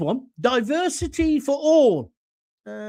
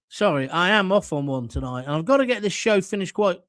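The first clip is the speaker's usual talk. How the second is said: ordinary talk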